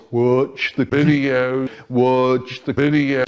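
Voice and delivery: slow, drawn-out mock-spooky voice; spoopy voice. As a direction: stretch every word like a silly ghostly spell. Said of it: "talking in his spoopy voice".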